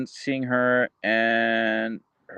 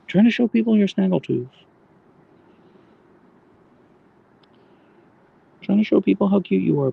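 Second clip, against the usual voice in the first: higher sing-song cooing; 9 semitones higher than usual. Real, not acted. Owner cooing at her dog, higher baby talk. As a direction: coo praise at the dog.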